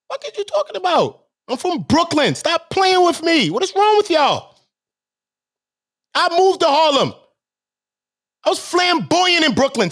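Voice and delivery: high-pitched, exasperated hollering; real indignant outburst; angry rant. Real, not acted. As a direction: tell them off in a high, heated voice. Angry rant defending his name.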